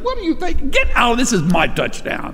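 high, brushing him off; mock-offended, shoo him away; indignant Roy. Roy Riegels rejecting his buddy, in mock indignation. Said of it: "He said".

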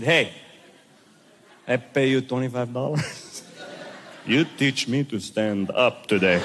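low, firm, mildly angry comeback; ticked off. Acted. Ticked pupil to ski instructor over the lesson fee, low annoyance. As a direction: drop the pitch and demand it, annoyed. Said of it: "I was really ticked".

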